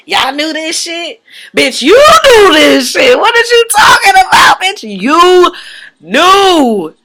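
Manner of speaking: high, loud accusing shout; angry yelling at crew. Retold or imitated. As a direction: Marie yells at the camera crew, furious and high. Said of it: "tried to get mad at the camera crew".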